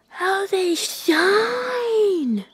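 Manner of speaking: greedy delight. Acted. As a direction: savour the shine like a greedy bird. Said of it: "said Magpie".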